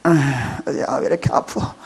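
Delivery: soft, whispered complaint of a sulky husband; hushed, pitiful husband grumbling; whining to wife. Retold or imitated. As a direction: moan to your wife for sympathy. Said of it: "그러다가 제 아내 오면은".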